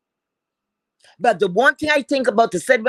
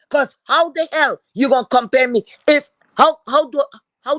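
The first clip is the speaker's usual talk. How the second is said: louder, higher, exasperated challenge; real indignant outburst; 4 semitones higher, 5 dB louder than usual. Real, not acted. Vlogger rejecting comparison with rivals, indignant outburst. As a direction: raise the voice in disbelief at the comparison.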